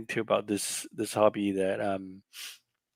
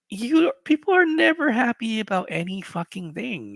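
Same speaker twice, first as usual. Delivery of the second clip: much higher, louder outburst; heated rant; 11 semitones higher, 4 dB louder than usual. Real, not acted. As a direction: let the frustration spill over.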